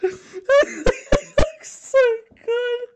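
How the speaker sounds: high, squeaky laugh-crying. Real, not acted. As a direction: wheeze it out through tears of laughter.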